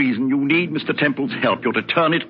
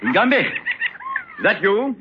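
tense call; 11 semitones higher, much slower than usual. Acted.

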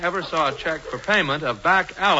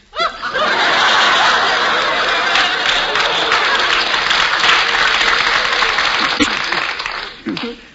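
drawn-out high-pitched cackle; long high laugh; 12 semitones higher, 4 dB louder than usual. Acted. Hubert's long signature laugh.

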